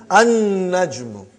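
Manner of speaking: slow An-najmu with a long held n; lam not pronounced. Shown as a demonstration. Teacher showing noon as sun letter, long n in An-najmu. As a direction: hold the double n of An-najmu, no l at all. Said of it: "You did not pronounce lam because noon is".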